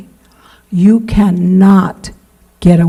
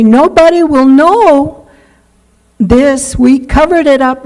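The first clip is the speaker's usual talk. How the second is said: higher, a bit louder, mock confident; lightly played self-assured sinner; smug secret-keeper; 5 semitones higher, 4 dB louder than usual. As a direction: brag that no one will find out. Voicing a sinner hiding it.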